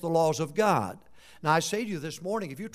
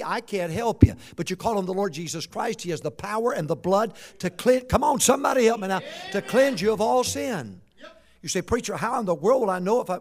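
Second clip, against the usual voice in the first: forceful preacher delivery, rising in volume; impassioned preaching; 3 semitones higher, 8 dB louder than usual. Real, not acted. Preacher urges the church to call on the Lord, impassioned preaching. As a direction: drive the scripture home with preaching force.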